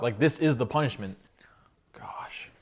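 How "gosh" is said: breathy whisper of pain. Real, not acted. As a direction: whisper it, worn out. In pain from sour lemon.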